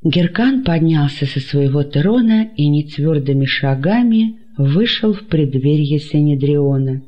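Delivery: plain reading voice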